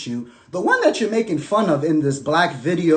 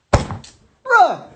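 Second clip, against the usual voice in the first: disbelief; 6 dB louder than usual. Real, not acted. Reacting in disbelief.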